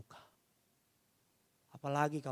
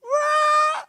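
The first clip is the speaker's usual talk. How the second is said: very high, loud imitated baby wail; child's crying wail; 13 dB louder than usual. Father imitating his child crying upstairs, piercing wail. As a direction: mimic a child bawling. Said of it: "Anak saya nangis".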